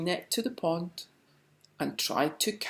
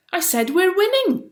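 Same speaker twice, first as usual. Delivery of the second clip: exasperated repeat; 9 dB louder than usual. A narrator's reading. Basil repeats himself impatiently.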